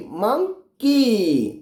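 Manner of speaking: "Monkey" very slow, मं, gap, long falling की; split syllables. Shown as a demonstration. Teacher answers what the parts are, "monkey" slowly split. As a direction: break "monkey" in two and stretch the की. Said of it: "क्या क्या है?".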